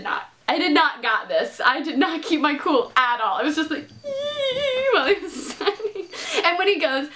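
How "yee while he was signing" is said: high squeal breaking into a laugh; excited yelp then laughter; giddy squeal. Retold or imitated. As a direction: squeal at a celebrity. Fangirl squeal while signing.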